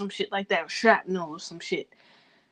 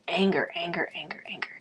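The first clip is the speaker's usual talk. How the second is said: whisper; 2 semitones lower, 7 dB quieter than usual. Real, not acted. Repeating anger, fading to whisper.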